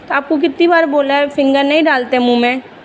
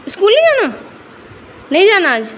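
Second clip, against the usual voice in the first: high, loud, swooping questions to a little one; 11 semitones higher, 5 dB louder than usual. Real, not acted. Mother asks her child about skipping school, high coaxing questions.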